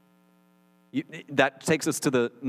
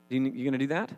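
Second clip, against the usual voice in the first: ordinary talk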